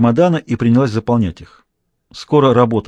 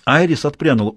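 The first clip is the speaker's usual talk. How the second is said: usual speaking voice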